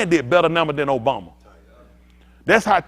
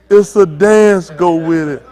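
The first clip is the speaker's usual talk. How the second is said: louder, slowed-down call to the crowd; loud crowd callout; 2 semitones higher, 11 dB louder, slower than usual. Retold or imitated. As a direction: announce it slowly and loud to everyone. Tells crowd to dance along.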